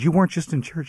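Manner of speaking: high, stunned disbelief; can't believe what he hears; incredulous. Retold or imitated. Disbelieving phone call retold.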